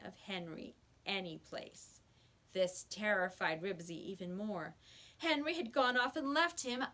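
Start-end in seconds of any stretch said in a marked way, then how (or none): none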